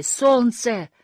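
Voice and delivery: high, drawn-out cry; shout. A narrator's reading. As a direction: cry it out to the horizon. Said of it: "закричал мумитроль".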